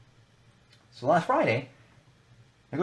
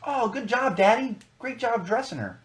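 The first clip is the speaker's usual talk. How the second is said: imitating a cooing older cashier, much higher; sweet cashier voice; 12 semitones higher than usual. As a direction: mimic a gushing checkout lady talking to a toddler, pitched way up. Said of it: "She goes".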